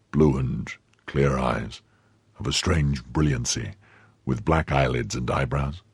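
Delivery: low, soft, measured recall; subdued questioning. Acted. Stricken musketeer checks her description, subdued. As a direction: list her features quietly and heavily.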